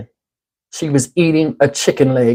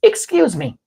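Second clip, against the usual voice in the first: mocking higher-pitched voice; irritating woman; 6 semitones higher, 3 dB quieter, slower than usual. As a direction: put on an annoying lady's voice. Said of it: "voice to people that irritate me".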